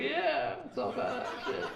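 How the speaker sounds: mock cool guy, throwaway; bro dude voice. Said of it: "he's like".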